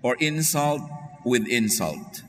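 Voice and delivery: slow, deliberate verse reading; measured Bible reading; scripture reading. Acted. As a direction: read the verse with weight. Reads scripture verse aloud.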